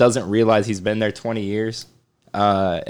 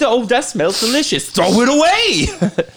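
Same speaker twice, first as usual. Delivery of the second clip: mocking quote; 9 semitones higher than usual. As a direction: ham up the roux expert's verdict. Mocking the roux advice guy.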